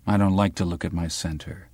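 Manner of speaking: plain reading voice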